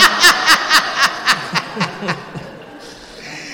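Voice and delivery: high, loud cackle trailing on for seconds; real helpless peal of laughter; loud burst of laughter. Real, not acted. Guest reacting to the host's anecdote, loud high laughter.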